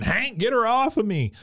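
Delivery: high, pleading mock scream; retold scream. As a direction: yell for Hank to pull her off. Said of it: "Peggy is screaming".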